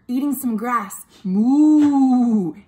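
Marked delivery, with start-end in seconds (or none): 1.2-2.6 long, loud moo; big playful cow noise. Acted. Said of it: "it's a cow".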